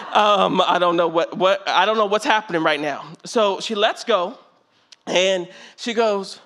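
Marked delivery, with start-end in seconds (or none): none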